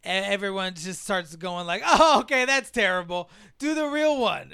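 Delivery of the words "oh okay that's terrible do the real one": acted crowd heckle. Acting crowd demanding real voice.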